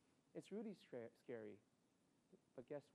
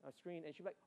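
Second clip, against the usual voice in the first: usual speaking voice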